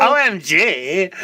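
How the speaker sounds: slow, high, laughing O M G; mock shock. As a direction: drag out a hammed-up O M G. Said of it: "Do that W T F again".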